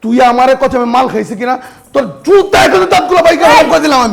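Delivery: loud, enraged threats; furious yelling. Acted. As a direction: bellow at your brother. Brothers' fight escalates.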